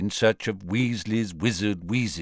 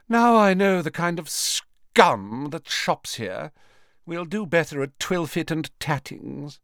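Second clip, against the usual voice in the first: contemptuous; 7 semitones higher than usual. A narrator's reading. Mother snubbing the shop's customers, contemptuous. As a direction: linger disdainfully over the insult. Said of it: "with a contemptuous glance at Hermione".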